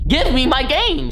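high drawn-out whining demand; whiny demand. Retold or imitated. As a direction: beg for the game like a frustrated kid. Wants his game back.

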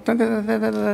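high nonsense syllables mimicking a Chinese accent; a Chinese-accented grocer calling the mother, imitated in high syllables; mock Chinese accent. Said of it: "in that Chinese accent".

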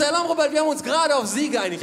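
the cop losing patience; exasperated cop. Retold or imitated. Officer correcting father's informal address, flustered.

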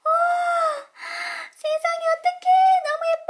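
very high, loud gushing; shrieking amazement; delighted squeal. Real, not acted. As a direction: gush over the sparkle. Toy lights up.

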